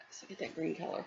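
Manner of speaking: normal talking voice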